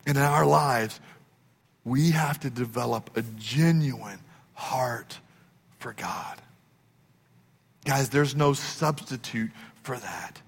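fading to a breathy whisper on for God; whispered sermon point, very soft; hushed. Acted. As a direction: drop to an intimate whisper. Sermon climax drops to hush.

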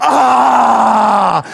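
rough imitated dunking roar; little boy's yell. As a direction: roar like a boy slamming in a basket. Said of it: "and he goes".

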